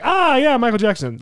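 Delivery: high-pitched imitation of delighted recognition; mock excited recognition. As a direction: act out suddenly knowing the famous name. Imagined listeners recognizing the name.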